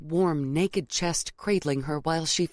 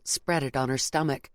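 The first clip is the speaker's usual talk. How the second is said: usual speaking voice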